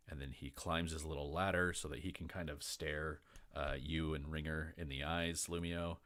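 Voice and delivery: ordinary delivery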